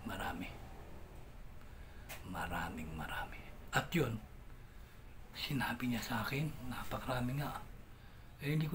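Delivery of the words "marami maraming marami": low, mysterious whisper. Retold or imitated. Fortune teller hinting at his future, in a low whisper. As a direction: hush it like an oracle.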